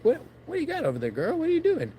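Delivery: squeaky high voice, sweet-talking the dog; mock high-pitched call to the dog. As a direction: voice jumps way up, coaxing a pet. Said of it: "We were like".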